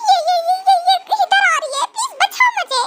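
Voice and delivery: high frantic stammering pleas; panicked cries. Acted. Bride crying for help as the lizard approaches. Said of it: "इसे चिल्लाना बंद करो".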